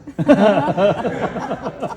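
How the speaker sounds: loud delighted laugh. Real, not acted. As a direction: break into laughter. Laughs at son's reply.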